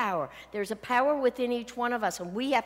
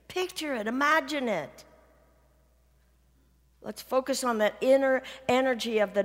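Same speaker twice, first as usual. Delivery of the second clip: louder, driving sermon build; forceful preaching; 3 semitones higher, 4 dB louder than usual. Acted. Preacher urging the congregation to imagine, forceful. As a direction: push the congregation to picture it.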